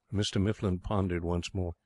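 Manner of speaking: ordinary delivery, nothing marked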